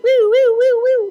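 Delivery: high loud woo sirens; vocal warm-up. Shown as a demonstration. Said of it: "Can I go higher?".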